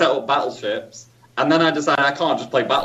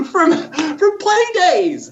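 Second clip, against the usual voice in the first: very high, drawn-out squawky puppet voice; high comic Scouse bird voice; Wybird; 14 semitones higher, slower than usual. Pretend. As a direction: squeal it like a children's TV bird. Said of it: "sound a bit like Wybird".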